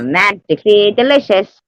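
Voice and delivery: a quick, hammed-up Irish cereal-ad lilt; attempt at Irish accent. Shown as a demonstration. Friend showing her attempt at an Irish accent, lightly done. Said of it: "that's my attempt at Irish".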